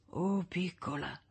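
slow, hushed murmur through swollen lips; soft, drawn-out whisper. A narrator's reading. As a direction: whisper it half-asleep, very softly. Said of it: "sussurrò tra le labbra gonfie e screpolate".

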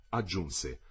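ordinary delivery, nothing marked

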